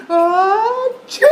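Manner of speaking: loud, high achoo; big sneeze. Acted. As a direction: fire off a full cartoon sneeze. Piggie sneezes again.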